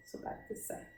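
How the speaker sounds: breathy whisper under her breath; whispered aside. Real, not acted. Quiet aside during tongue exam.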